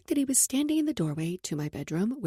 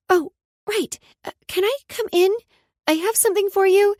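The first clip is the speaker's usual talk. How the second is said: put-on excited little girl; 10 semitones higher, 8 dB louder than usual. Child voice, Brooklyn.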